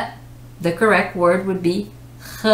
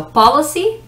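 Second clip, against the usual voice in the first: POLICY with POL punched higher and louder; first-syllable stress; 8 semitones higher, 5 dB louder than usual. Shown as a demonstration. Teacher explaining where stress goes, first-syllable stress on policy. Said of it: "stress of the syllable is the first".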